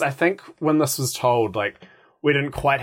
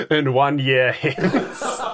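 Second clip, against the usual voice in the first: said through a laugh, slowly; giggling out the line; much slower than usual. Real, not acted. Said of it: "prompting the knight line, laughing".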